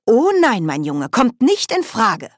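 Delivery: put-on high, loud old-woman scolding; scolding old witch. A narrator's reading. Nanny Ogg scolds Grebo, high and loud. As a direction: tell the cat off sharply.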